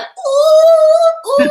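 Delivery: very high, loud mock moan of delight; hammed-up swooning "ooh"; woman overcome, high squeals. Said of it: "she's like".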